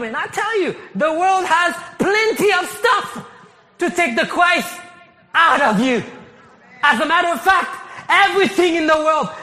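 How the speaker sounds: high, loud preaching build, voice straining; full-force preaching. Acted. Pastor preaching to his church, loud and fervent. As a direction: drive it home to the congregation at full force.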